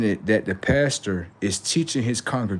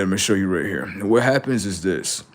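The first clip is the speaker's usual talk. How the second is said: ordinary talk, nothing marked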